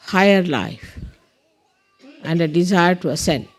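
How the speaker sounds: deliberate prayer leader with long pauses; measured meditation instructions with space to follow; guided meditation. Real, not acted. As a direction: guide them through it, phrase by phrase.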